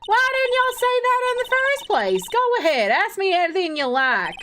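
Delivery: put-on Southern accent. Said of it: "Southern accent localization".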